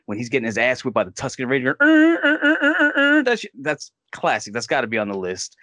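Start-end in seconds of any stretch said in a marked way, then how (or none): 1.8-3.0 strangled high uh-uh-uh grunts; mock pained stammer. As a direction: choke out the grunts like he's getting beaten. Imitating Luke being whipped.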